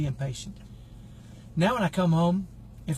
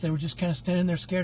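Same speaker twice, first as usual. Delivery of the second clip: ordinary delivery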